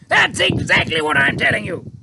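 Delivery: high, loud exasperated officer; cried. A narrator's reading. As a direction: Air Force chief crying at the army chief, loud. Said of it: "cried the Air Force man".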